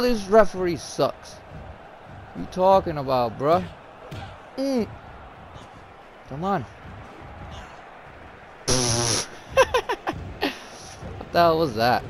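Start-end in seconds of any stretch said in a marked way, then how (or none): none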